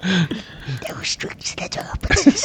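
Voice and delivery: Gollum whisper. Pretend. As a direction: whisper like a creepy cave creature. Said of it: "how does Gollum whisper".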